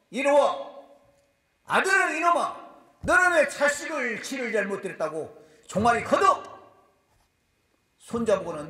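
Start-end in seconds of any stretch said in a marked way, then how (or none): 0.1-6.4 loud, higher scolding shout; angry grandfather. Retold or imitated. Preacher acting grandfather scolding his son, loud angry shout. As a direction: roar at the son like an angry elder.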